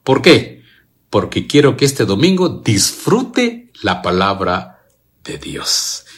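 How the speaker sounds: normal talking voice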